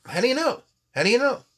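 high-pitched, incredulous questioning; challenging doubter. Acted. Doubters pressing the prophet for assurance, challenging. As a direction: throw it out as a high, insistent challenge.

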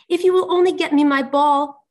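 high, loud princess plea; pleading princess. A narrator's reading. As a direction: beg eagerly as the storybook princess. Princess pleads for her ball.

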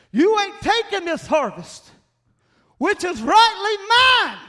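a preacher yelling at the Philistines; shouted defiance. Retold or imitated. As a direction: roar it out to the enemy. Repeat of quote, escalating.

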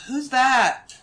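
put-on a drowsy kid calling down the hall; young girl's voice. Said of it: "you hear a small voice".

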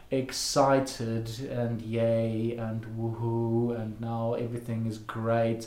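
low, soft, lifeless yay and woohoo, slowly; flat fake cheer. Shown as a demonstration. Coach shows listeners incongruent self-talk, dead flat and low. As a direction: cheer words with no excitement at all. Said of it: "speaking in an excited tone".

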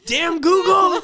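high shouted curse, laughing; mock outburst. Mock frustrated coworker cursing Google.

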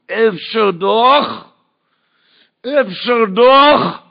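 slow, high, loud Yiddish-tinged plea; rabbi's drawn-out cry. Retold or imitated. Rabbi shouting to the boy, slow and high. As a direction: stretch each word like a wailing call. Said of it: "והוא צעק לי".

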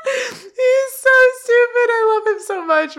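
high, drawn-out gushing after laughter; real helpless affectionate amusement; fond hilarity. Real, not acted. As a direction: squeal it, still giddy from laughing. Gushes after laughing at the character.

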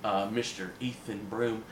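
ordinary talk

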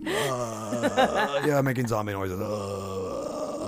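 low zombie groan. Pretend. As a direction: moan like the undead. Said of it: "That sounded like a horror sound".

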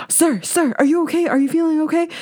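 lightly voiced alarmed nurse; worried nurse. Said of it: "the nurse was like".

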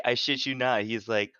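ordinary delivery

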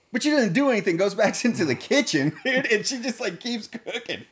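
laughing through the words, high and loud; can't stop laughing while telling it. Real, not acted.

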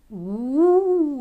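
loud, high swooping "uuu"; drawn-out "ooh". A narrator's reading. Second girl echoing her friend, loud high "ooh". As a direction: swell a long, high hoot. Other girl echoes the exclamation.

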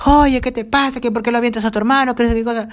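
high-pitched imitation of mom telling off her kid; indignant parental telling-off, acted out; scolding mother yelling. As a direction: scold in a mock outraged shriek. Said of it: "Literalmente me gritaron a mí".

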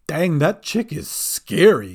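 lightly voiced goblin, drawn out. A narrator's reading. Bink surfaces shaken by Dolly, slow goblin voice.

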